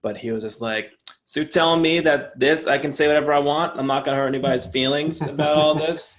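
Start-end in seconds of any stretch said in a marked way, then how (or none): 1.2-6.2 challenging New Jersey guy, louder and higher; New Jersey accent. Retold or imitated. Focus group guy questioning the moderator, mock New Jersey accent. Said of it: "make this accent and make fun".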